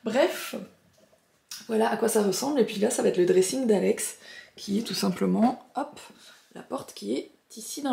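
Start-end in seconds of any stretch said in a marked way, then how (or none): none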